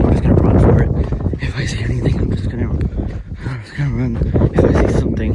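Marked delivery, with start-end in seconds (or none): 0.0-0.9 loud, urgent whisper. Real, not acted. Vlogger ready to flee the monster, loud urgent whisper. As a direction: push it out ready to bolt.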